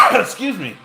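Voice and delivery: loud, strained cough after swallowing wrong; coughing up water. Real, not acted. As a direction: clear the throat after a choked sip. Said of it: "I had a liquid gone, go down the wrong pipe here".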